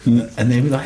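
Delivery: ordinary delivery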